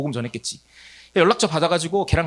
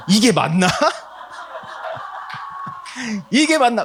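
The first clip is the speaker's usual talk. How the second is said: laughing doubt; 7 semitones higher than usual. Retold or imitated.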